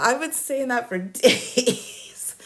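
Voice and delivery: ordinary talk, nothing marked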